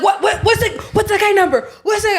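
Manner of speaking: high, loud, frantic pleading; a mock panicked locksmith begging; imitating a frantic locksmith begging for the number; stressed guy. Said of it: "that guy's stressed".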